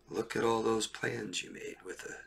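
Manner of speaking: hushed, breathy warning to the listener; whisper. Real, not acted. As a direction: whisper it like a secret reproach.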